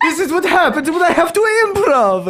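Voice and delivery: high, loud mock-furious Italian accent; angry Italian. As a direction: rant as a hammed-up, outraged Italian. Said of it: "be as angry as possible as an Italian".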